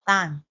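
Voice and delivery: "time" with the first t softened, no crisp puff; mispronounced time. Shown as a demonstration. Teacher showing Vietnamese learners' slip, mispronounced "time". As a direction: say "time" with a substituted, non-English first sound. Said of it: "students are unaware of the sound t".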